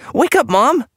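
very high, loud frantic cry; urgent shout. A narrator's reading. Boy tries to wake his bleeding mother, shouting. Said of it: "he shouted".

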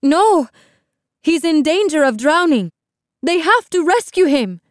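high, loud, frantic protest; crying out. A narrator's reading. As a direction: plead urgently for his rescue. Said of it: "Amara cried".

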